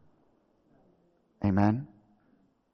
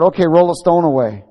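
loud, raised order; commanding Jesus; 4 semitones higher, 9 dB louder than usual. Acted. Jesus ordering the tomb opened, loud command. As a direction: call out the order firmly. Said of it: "Jesus said".

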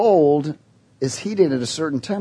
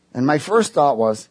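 normal talking voice

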